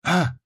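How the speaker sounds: short, high, soft "ah!"; a small startled cry, kept quiet. A narrator's reading. Dufour as soldiers come into sight, crying out quietly. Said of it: "тихо вскрикнул Дюфур".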